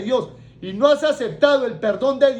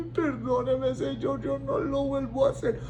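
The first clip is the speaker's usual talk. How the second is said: higher, softer tearful begging; mock weeping plea; 5 semitones higher, 7 dB quieter than usual. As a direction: plead to God as if sobbing. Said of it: "pero llorando, a moco tendido". (súplica llorosa imitada)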